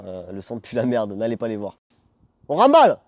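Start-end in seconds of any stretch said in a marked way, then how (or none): 2.5-3.0 high loud call to wrap up; shout. Real, not acted. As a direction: call it out to the crew. (cri pour remballer)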